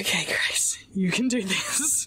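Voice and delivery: whispered self pep talk; whispering. Real, not acted. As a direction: whisper encouragement to yourself. Psyching herself up mid-game.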